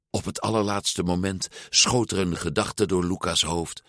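usual speaking voice

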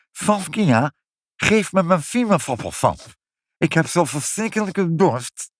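high voice, every "s" an "f", as in "vingerfoppelfap"; lisping demand. Acted. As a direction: demand a drink through a thick lisp on "dorst". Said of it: "Ik kan de F niet zeggen".

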